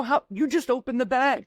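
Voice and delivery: high-pitched incredulous protest; retold disbelief. Dad replying to stale-chip complaint, incredulous. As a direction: retell it with the voice jumping up in disbelief.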